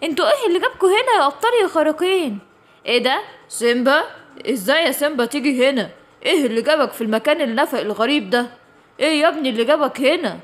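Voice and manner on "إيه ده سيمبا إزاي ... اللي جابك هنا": put-on lower, calm voice of a wise old creature; low old guardian. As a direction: speak as the kindly keeper of the tunnel. Voices the older cave figure. (صوت عجوز حكيم هادي)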